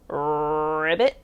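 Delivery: low rolled-r croak, sliding up; pig's frog croak. A narrator's reading. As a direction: croak it like a frog, ending on a rise.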